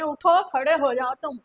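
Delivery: loud, cross teacher's command; stern order. Acted. Teacher tells a failing pupil to stand up, loud and stern.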